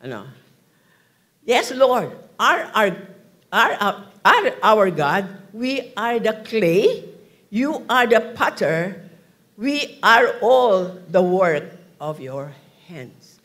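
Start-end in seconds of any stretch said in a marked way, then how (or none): 1.5-13.4 loud and slow, each phrase stretched out; slow declaimed scripture. Acted. Preacher reads Isaiah to the congregation, loud and slow. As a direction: proclaim it like an invitation to prayer.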